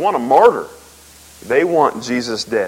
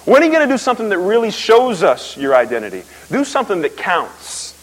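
higher, louder taunting demand; mocking challenge; 10 semitones higher, 5 dB louder than usual. Preacher voicing Pharisees taunting Jesus, higher and louder mockery. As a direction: needle him like a smug heckler. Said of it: "have the guts and the nerve to walk up to him and say".

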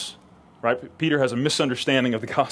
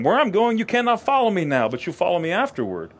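voicing Jesus; 7 semitones higher, faster than usual. Jesus answering Peter, read aloud.

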